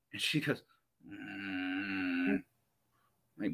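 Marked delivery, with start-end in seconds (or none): 1.1-2.4 low, soft half-asleep "mm"; sleepy groan. Retold or imitated. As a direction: imitate someone groaning, refusing to wake. Mom barely waking up.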